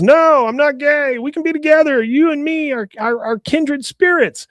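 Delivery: high-pitched, acted desperate protest; desperate pleading. Bill desperately protests to client.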